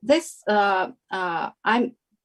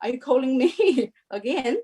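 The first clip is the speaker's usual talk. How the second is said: much higher, laughing surprised retold reaction; amazed disbelief; 5 semitones higher than usual. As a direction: retell being stunned, squeaking up with a laugh. Retelling being called again.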